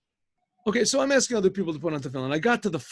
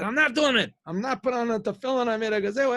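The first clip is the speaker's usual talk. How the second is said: high-pitched retold protest; mock indignant refusal, higher voice; stubborn refusal; 7 semitones higher, faster than usual. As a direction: act out digging your heels in, pitch raised. Retold quarrel, man refusing.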